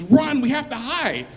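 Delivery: loud, high, slowed urgent yell; panicked cry. Retold or imitated. Cleaning guy grabbing the narrator, yelling high and loud. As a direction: yell at him to run and hide in panic.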